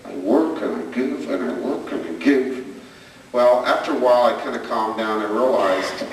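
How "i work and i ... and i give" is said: self-pitying grumble. Retold or imitated. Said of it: "I sat there going".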